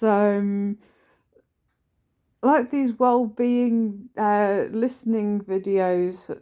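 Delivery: ordinary delivery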